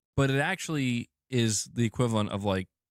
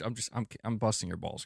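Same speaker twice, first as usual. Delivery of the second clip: ordinary talk, nothing marked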